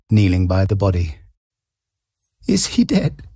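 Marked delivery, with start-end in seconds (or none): 2.5-3.2 high, frightened question; anxious cry. A narrator's reading. Wife asking the policeman, high anxious cry. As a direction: cry out fearfully. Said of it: "she cried".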